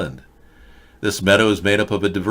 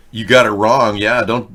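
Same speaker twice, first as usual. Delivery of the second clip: usual speaking voice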